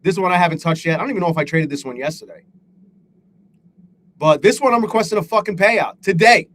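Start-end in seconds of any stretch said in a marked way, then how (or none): none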